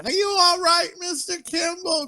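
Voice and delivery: high, whiny kid imitation; mocking the annoying kid from the movie, high whine.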